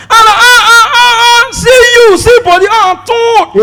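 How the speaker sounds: high, loud squealing friend reunion; excited woman greeting. Retold or imitated. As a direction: mimic a woman spotting an old friend. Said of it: "A woman can see her friend".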